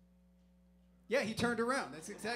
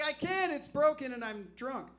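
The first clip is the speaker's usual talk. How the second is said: pleading reply; 7 semitones higher, 9 dB louder than usual. Retold or imitated. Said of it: "I'm like".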